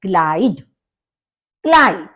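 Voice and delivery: क्लाइड with ai as in eye; teacher models क्लाइड twice; German pronunciation. Shown as a demonstration. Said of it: "हम इसको कैसे pronounce करेंगे". (क्लाइड, आई की ध्वनि के साथ)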